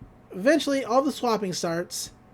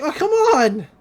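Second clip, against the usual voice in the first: louder and higher, mock exasperated yell; frustrated outburst; 8 semitones higher, 7 dB louder than usual. Dipper protests his body being taken, frustrated. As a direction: throw up your hands in exasperation. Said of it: "And he's like".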